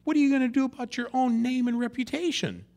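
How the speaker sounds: high and loud, pressing God for an answer; pleading challenge. Retold or imitated. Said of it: "And then he says".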